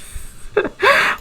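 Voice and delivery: airy high laugh with no voice; breathy laugh. Real, not acted. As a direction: let a breathless laugh slip out. Laughs at the Sim.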